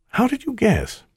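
high-pitched, caught-out question; curious question. A narrator's reading. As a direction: ask it high, as if found out. Disguised speaker asks the spirit.

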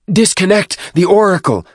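high, loud command; urgent shout. A narrator's reading. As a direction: shout the order in alarm. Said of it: "Fortitude shouted".

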